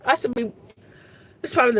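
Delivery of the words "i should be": ordinary delivery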